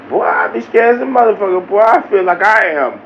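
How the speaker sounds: ordinary delivery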